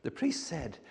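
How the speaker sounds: usual speaking voice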